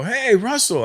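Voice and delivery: high retold happy hail; imitating a delighted call-out; excited greeting. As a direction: replay an eager hello to an old acquaintance. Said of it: "I'm excited to see the guy".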